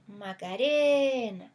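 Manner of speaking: Macarena con la E tónica estirada; vocal alargada. Shown as a demonstration. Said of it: "si yo quisiera exagerar la palabra Macarena". (estira la E acentuada de "Macarena")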